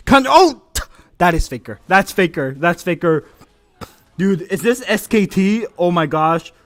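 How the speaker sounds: loud, excited caster calls; hyped play-by-play. Acted. Streamer casting his friends' match, excited play-by-play. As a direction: call every play like a shoutcaster.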